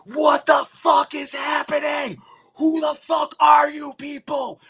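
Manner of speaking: high, slowed, frantic yell; retold panicked scream. Seeing their own dead clones, shouting in panic. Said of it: "we are literally all screaming".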